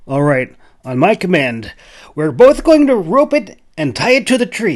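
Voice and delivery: high, loud orders; commanding hunter. A narrator's reading. Jack announcing the roping plan, commanding. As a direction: give the plan like a leader. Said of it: "he announced".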